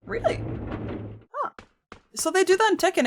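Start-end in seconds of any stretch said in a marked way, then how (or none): 0.0-1.5 surprised. Real, not acted. Disbelieving reaction to chat.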